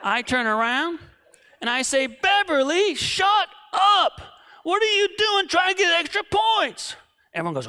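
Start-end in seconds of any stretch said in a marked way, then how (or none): 2.1-7.0 high, loud shout at a classmate; yelling. Retold or imitated. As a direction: scold her at full volume. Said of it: "I yell at her".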